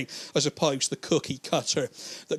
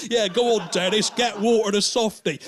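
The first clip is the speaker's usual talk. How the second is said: loud, higher mock cheering; egging on; 5 semitones higher, 4 dB louder than usual. Bullied child cheers on Dennis from the page, loud and eager. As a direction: urge him on like an excited young fan.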